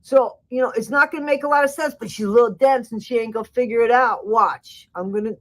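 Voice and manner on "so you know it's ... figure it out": higher-pitched imitation of a Bayou accent as Ken. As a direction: put on a playful Bayou accent to mimic him. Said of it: "Do I do that Bayou accent good?".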